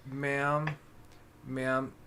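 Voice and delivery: very slow, drawn-out polite warning; slow polite guard. Acted. Guard slowly warning the lost tiefling, drawn-out and polite. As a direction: stretch every word like a patient jailer. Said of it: "like that".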